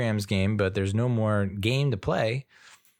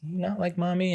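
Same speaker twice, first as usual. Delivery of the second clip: put-on very high little-girl questions; imitating daughter; 11 semitones higher than usual. Dad retelling his daughter asking about mommy, high childlike. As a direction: squeak it like a curious small kid. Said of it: "she goes".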